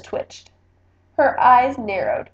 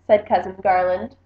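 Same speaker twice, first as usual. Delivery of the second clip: plain reading voice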